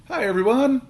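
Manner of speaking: high, loud, bright hello; cheerful greeting. A narrator's reading. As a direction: greet everyone with big cheer. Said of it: "he said cheerfully".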